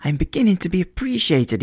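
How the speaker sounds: put-on high, delighted young man crying out; a struggling writer thrilled at a gift; excited cry. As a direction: burst out with sudden joy. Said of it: "he cried".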